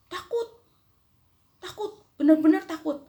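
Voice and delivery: put-on high, childlike voice, mildly fearful with a laugh; child's voice insisting she is scared; scared little girl. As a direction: play a frightened child, half laughing.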